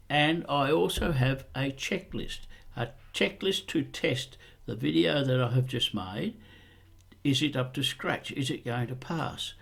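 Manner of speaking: natural voice sample. Shown as a demonstration. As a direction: let your ordinary speaking voice come through, quietly. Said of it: "a little sample of my natural voice here".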